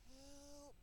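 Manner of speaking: a weak little help, barely voiced; timid high plea for help; feeble cry for help. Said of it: "he lets out a feeble".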